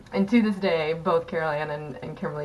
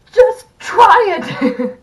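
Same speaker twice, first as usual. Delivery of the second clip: much higher, much louder, slow shout; strangled shout; 15 dB louder, much slower than usual. Retold or imitated. Actress with cake in her mouth shouting at the castmate, slow and much higher. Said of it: "she just goes".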